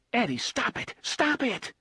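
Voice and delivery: high, urgent British-free plea to stop; alarmed plea. Acted. Susanna begs him to stop.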